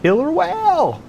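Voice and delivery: high, slow holler of alarm; shouting back. A narrator's reading. Jet ski driver warning Derek of the whale, shouting back. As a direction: yell it high and drawn out. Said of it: "I shouted back".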